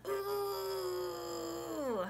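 long, high "ooh" while hugging; drawn-out pretend hugging sound; big-hug squeal. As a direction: hold a high squeeze noise, falling at the end.

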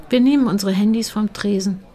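usual speaking voice

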